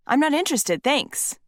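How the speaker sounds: clipped high no thanks; curt refusal. Retold or imitated. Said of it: "is what I thought but didn't send".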